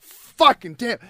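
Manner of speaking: high mock-frustrated outburst; frustrated curse. Guy retelling the tire that didn't pop, mock frustration.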